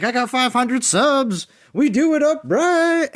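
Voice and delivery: high, slow, boastful mock voice; imitating Chef. Streamer mimics Chef bragging, high slow mock voice. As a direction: puff up like a streamer bragging about subs. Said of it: "He's like".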